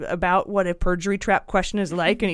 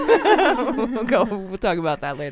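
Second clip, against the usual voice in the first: louder, higher, laughing through it; 4 semitones higher, 4 dB louder than usual. Real, not acted. Host laughs off his own rain joke. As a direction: giggle while moving on.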